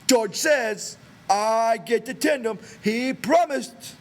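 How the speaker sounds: put-on high, loud, very slow childlike insistence; cling to the promise like a child; Lennie's pleading voice. A narrator's reading. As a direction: Lennie reminding them what George promised.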